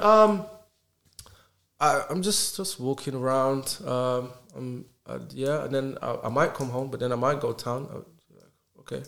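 hesitant, halting, trailing into ums; unconfident. Acted. Someone asked where they are going, answering unconfidently. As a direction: fumble for an answer, unsure of yourself. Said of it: "that's not confident".

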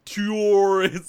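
overdone "tourist" with a long U; accented U. Shown as a demonstration. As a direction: push the oo in "tourist". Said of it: "some people accent the U more".

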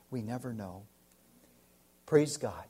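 ordinary talk

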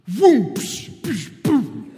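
loud punchy boom and psh noises; mouth sound effects. Retold or imitated. Coach hauling his son from the river, loud sound effects. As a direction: blast out the rescue like action sounds. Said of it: "just goes".

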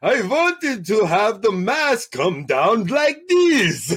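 mock a terrible Italian accent, drawn out; bad Italian accent. Host imitating a movie's bad Italian accent for his co-host. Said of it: "the bad Italian accent, he's like".

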